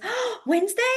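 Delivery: high gasp of recognition; high-pitched delighted oh, Wednesday; excited kids. Retold or imitated. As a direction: act like kids spotting a star. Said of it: "they all kept doing".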